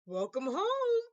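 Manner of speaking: lightly voiced father; a gentle dad greeting; father's welcome. A narrator's reading. Father welcoming daughter home, lightly voiced. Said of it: "said her father".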